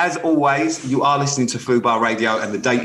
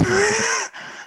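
embarrassed laugh. Real, not acted. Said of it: "no, I can't".

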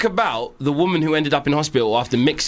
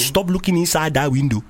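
put-on Nigerian accent. Keeps up the Nigerian accent bit.

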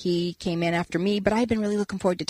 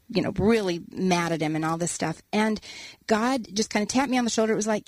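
ordinary delivery

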